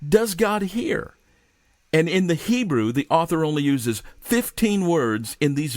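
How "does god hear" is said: "Does God hear?" high and drawn out slowly; slow plea. Retold or imitated. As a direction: ask it as a doubting people, slowly. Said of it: "They asked".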